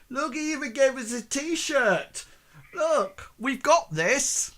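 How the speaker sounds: high, pleased gang member voice; cocky thug. Acted. Thug waving the t-shirt at his backing-off mate, high and smug. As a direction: show off the prize to your mate. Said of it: "and say".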